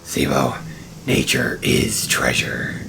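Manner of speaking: very low, slow, breathy stoner; deep stoner voice. Acted. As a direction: drawl it out like a spaced-out sage. Ramaj voiced as stoned sage.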